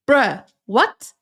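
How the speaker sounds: high, loud disbelief; can't believe the request; incredulous bruh. Retold or imitated. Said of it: "And I'm like".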